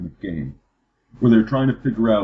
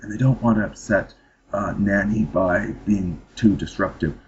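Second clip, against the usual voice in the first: lightly imitated radio woman, slowing down; a hint of careful radio presenter; NPR woman voice; 2 semitones lower than usual. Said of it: "All, all the women talk like that".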